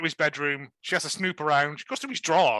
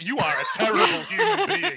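high, louder outraged laugh; laughing mock outrage; 11 semitones higher, 5 dB louder than usual. Telling off the lazy detective, laughing outrage. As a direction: scold him through a laugh. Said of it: "And I'm like".

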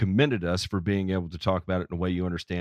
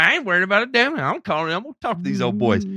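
higher, louder hammed-up country good old boy; good old boy caller; 9 semitones higher, 3 dB louder, faster than usual. Host imitating the brave caller, hammed-up good old boy. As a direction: ham up a carefree Southern caller. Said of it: "He's like".

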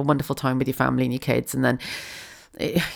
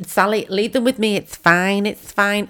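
mum voice; 6 semitones higher, slower than usual. Retold or imitated. Mum brushing off the daughter.

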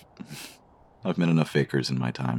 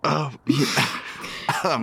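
laughing; 11 semitones higher, slower than usual. Acted. Still laughing.

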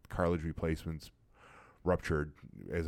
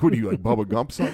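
mock teasing jab; 5 semitones higher, 11 dB louder, faster than usual. Mocking teammate's chewing tobacco lip.

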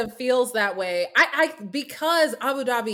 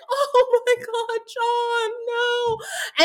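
hammed-up sobbing plea, much higher and slow; mock crying; 12 semitones higher, much slower than usual. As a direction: beg through fake sobs. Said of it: "crying, like".

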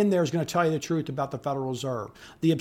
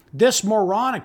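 normal talking voice

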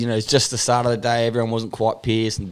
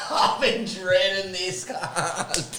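nervous laugh; 8 semitones higher, 6 dB quieter, much slower than usual. Real, not acted. Laughs nervously before being roasted.

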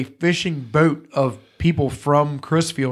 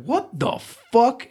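retold bafflement; 8 semitones higher, slower than usual. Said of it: "We were like".

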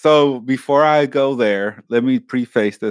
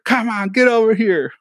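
high, mock-bossy order; scolding mom imitation; 10 semitones higher than usual. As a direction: order the kid over like a fed-up mom. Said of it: "drag me by the ear".

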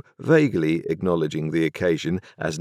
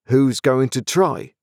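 indignant retort; 8 semitones higher, 5 dB louder, slower than usual. A narrator's reading. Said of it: "retorted Joe".

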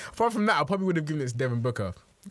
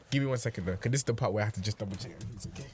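usual speaking voice

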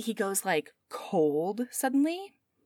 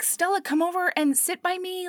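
a hint of a sweet mom, higher; 9 semitones higher than usual. Mom calling Stella to the table, a touch higher. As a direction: coax her over sweetly. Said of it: "she's like".

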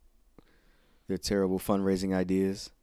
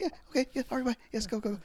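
much higher, hurried goodbye; flustered girl voice; 4 dB quieter, much faster than usual. Retold or imitated. Girl shooing the wrestler away, much higher and hurried. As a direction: rush him off awkwardly. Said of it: "She's like".

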